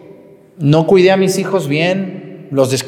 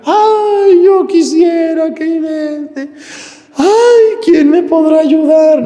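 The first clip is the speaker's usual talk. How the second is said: much higher, louder, slow whiny lament; mock weeping penitent; 10 semitones higher, 4 dB louder, much slower than usual. Priest parodying penitents who only cry, high slow whine. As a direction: ham up a helpless sinner moaning for rescue. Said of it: "no los arrepentidos llorando".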